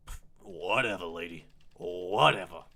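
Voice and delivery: scornful whatever with a scoff; dismissive. Acted. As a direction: brush the lady off. Kid dismisses the matron.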